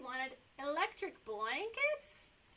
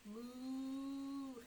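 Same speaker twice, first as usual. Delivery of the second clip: mock moo; 4 dB quieter than usual. Puppet cow in barn.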